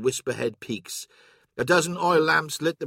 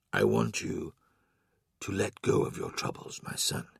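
much lower, half-whispered reassurance; soft comfort; 7 semitones lower, 7 dB quieter than usual. A narrator's reading. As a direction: ease his troubles in a hush. Horus comforts Loken.

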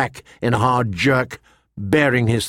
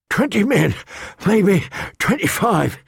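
breathless; 7 semitones higher than usual. A narrator's reading. Scout reports to Catelyn out of breath from the ridge, panting. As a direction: gasp it out after the climb. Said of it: "he said, breathless".

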